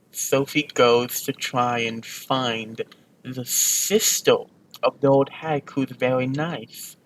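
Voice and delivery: plain reading voice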